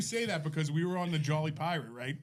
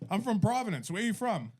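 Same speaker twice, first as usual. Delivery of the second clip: higher-pitched Rhode Island local; Providence guy; 7 semitones higher than usual. Retold or imitated. Providence man quizzes a fellow tourist, higher chummy voice. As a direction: chat up a stranger in a Providence voice. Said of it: "he's like".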